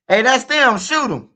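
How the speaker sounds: high, loud yell to shoot; shouting at his cousin to fire; urgent shout. Retold or imitated. Yelling at cousin to shoot the men.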